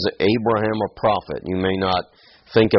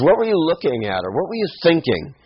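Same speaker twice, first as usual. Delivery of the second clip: high, fast, disbelieving questions; incredulous challenge; 9 semitones higher, faster than usual. Acted. Preacher rewords the king's question to Abraham, incredulous. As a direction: demand an explanation, pitch leaping up.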